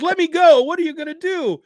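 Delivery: high, loud frantic pleading; panicked thug voice. Acted. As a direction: squeal it like a cornered crook. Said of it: "the guy goes".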